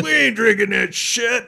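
slow, high, loud New York holler; drawn-out mock New York jeer; New York brogue. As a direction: imitate a scornful New Yorker shouting. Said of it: "great New York brogue".

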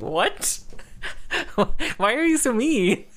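high, laughing mock outrage; laughing protest. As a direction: squeal it out between laughs. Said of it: "And I'm like".

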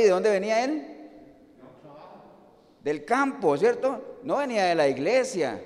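ordinary talk, nothing marked

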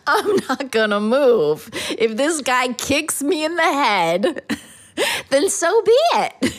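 high-pitched mock-heroic defiance; defiant. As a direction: stand your ground, comically dramatic. Acted defiant quote in story.

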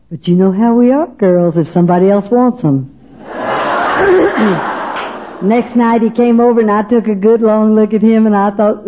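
ordinary talk

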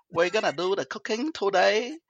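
slow mock Vietnamese accent cooking show; hammed-up Vietnamese accent, reading out a recipe. Podcast host doing a requested cooking bit in a mock Vietnamese accent. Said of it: "do it in the Vietnamese then".

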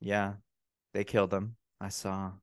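low, quiet, deadpan witness; imitating monotone. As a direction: testify softly in a lifeless monotone. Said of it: "monotone voice".

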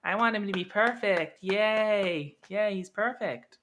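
put-on high, childish cheering; naive young kid. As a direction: gush like a little fan. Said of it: "you could be like".

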